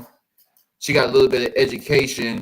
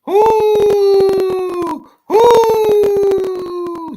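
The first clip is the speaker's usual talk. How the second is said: high, loud whooping holler; loud whoo; 11 dB louder than usual. Real, not acted. Whooping at the photos to the viewers. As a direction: holler in shocked delight.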